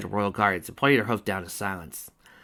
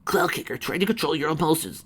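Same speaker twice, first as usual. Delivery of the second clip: scolding; 7 semitones higher, 3 dB louder than usual. Acted. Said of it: "scolded her friend".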